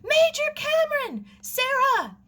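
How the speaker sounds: put-on frantic girl calling from the cliff path, shrill and loud. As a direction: shriek for help across the garden. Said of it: "A voice shrilled from the cliff path".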